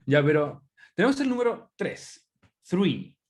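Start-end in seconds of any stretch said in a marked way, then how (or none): 2.6-3.2 three with tongue between the teeth; three with a th sound; English th. Shown as a demonstration. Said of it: "lengua entre medio de los dientes". (three con la lengua entre los dientes)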